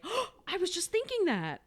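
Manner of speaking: gasp, then high-pitched mock astonishment; friend's amazed reaction. Girl startled at having her thoughts read, amazed. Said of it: "her friend would be like".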